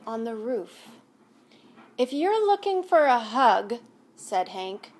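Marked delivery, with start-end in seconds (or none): 2.0-3.8 a touch louder, changed voice; softening cactus. Acted. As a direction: offer it gruffly but kindly. Hank softening to owl.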